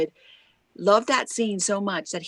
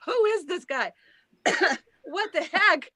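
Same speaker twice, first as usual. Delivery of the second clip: bemused disbelief; 12 semitones higher than usual. Retold or imitated. Bemused reaction to David.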